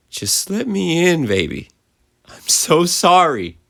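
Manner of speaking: high, slow tearful mother; crying plea. A narrator's reading. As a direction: beg through tears. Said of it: "she started to cry".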